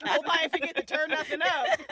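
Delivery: high, loud laughing fret; laughing mock worry. Podcaster laughing at her own mixing worries, high and loud.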